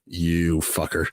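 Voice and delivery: low, drawn-out mock resentment; retold curse. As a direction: imitate her stewing, slow and low. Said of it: "and she was like".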